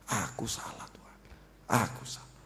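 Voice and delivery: soft, low admission of guilt; hushed confession. Acted. Preacher models confessing to God behind closed doors, hushed and low. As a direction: confess it quietly, as if alone with God.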